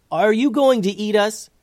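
put-on high, loud young hero's question; blunt question. A narrator's reading. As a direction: ask it straight out, pitched high. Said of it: "getting right to the point".

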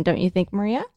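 ordinary talk, nothing marked

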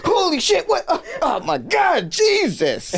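put-on older lady spooked mid-conversation; startled panic. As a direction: panic at a cough behind her. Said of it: "She goes".

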